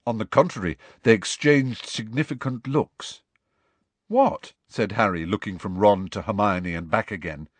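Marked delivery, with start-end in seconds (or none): 4.1-4.5 sharp high surprised question. Acted. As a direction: blurt it out, caught off guard. Harry surprised.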